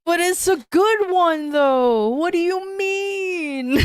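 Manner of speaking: high, drawn-out mock whine; pleading in defence of a silly joke; playful protest. Real, not acted. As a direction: stretch it out high, laughing at the end.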